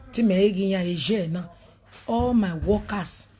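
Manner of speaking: ordinary talk, nothing marked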